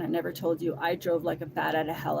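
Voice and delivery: ordinary delivery, nothing marked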